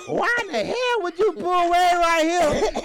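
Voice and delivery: high, drawn-out angry rant; old lady going in. Retold or imitated. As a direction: imitate an outraged elderly passenger. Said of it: "she go in".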